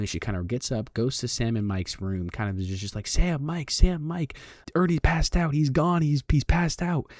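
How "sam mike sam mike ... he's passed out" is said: high mock-frantic calling for help; high-pitched panicked cries, retold; panicked girlfriend. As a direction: imitate her raising the alarm, voice pitched right up. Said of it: "really bad panic attack".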